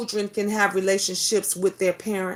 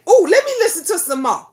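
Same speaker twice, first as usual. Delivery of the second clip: lightly voiced eager child, higher, louder and fast; quick, keen little-girl plea; mother imitates daughter; 7 semitones higher, 6 dB louder, faster than usual. Mother voicing her daughter wanting more of the video, eager. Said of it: "She say".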